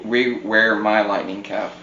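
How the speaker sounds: usual speaking voice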